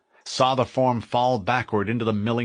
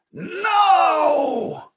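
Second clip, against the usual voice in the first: high, loud defiant scream; 5 dB louder than usual. Acted.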